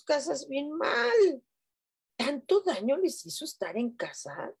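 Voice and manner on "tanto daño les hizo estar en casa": usual speaking voice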